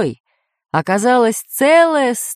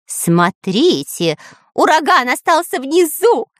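high, delighted cry; joyful; 7 semitones higher than usual. A narrator's reading. Dyrka rejoices that the hurricane stayed below, joyful. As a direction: crow with joy, pitch climbing high. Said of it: "радовался Дырка".

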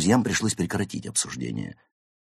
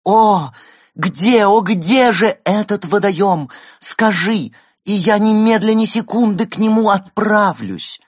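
high, loud stagey plea; overacted heroine begging; theatrical pleading; 7 dB louder than usual.

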